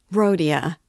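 loud, startled cry of the name. A narrator's reading. Mother cries out to her son, loud exclamation. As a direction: call out in protest. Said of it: "cried Pulcheria Alexandrovna".

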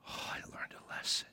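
breathy hushed whisper. Real, not acted. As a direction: let it slip out in a whisper. Whispered aside to congregation.

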